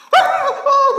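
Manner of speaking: very high, loud fake sobs; loud mock bawling. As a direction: bawl like a child mid-injection. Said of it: "saking kerasnya nangis". (raungan tangis anak dibuat-buat)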